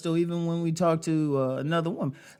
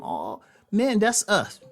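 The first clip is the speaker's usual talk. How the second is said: ordinary talk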